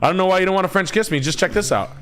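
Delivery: high, rushed imitation of a bar flirt; mock pickup line. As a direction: act out a hurried, high-pitched come-on. Repeating his bar pickup line.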